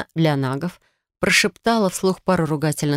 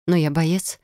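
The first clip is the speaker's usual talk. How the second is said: ordinary delivery, nothing marked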